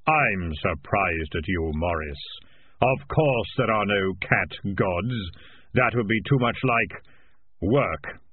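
slow, measured voice of Death; solemn, deliberate Grim Reaper. Acted. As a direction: pronounce it slowly as Death. Death speaking.

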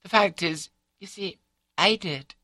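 high, halting wife's voice; confessing wife. A narrator's reading. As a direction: own up in small pieces, pitched high. Wife confesses to her husband.

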